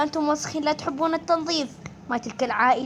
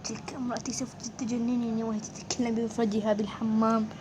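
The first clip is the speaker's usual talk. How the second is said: hushed whisper aside; secretive whispered complaint; 4 semitones lower, 6 dB quieter than usual. Acted. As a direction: whisper it so she can't hear. Muttering about his wife.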